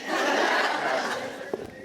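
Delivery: breathy burst of laughter; real laugh. Real, not acted. Speaker asked what mom said, laughing out loud. Audience question about mother.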